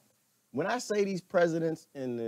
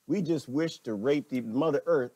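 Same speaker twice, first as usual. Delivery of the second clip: normal talking voice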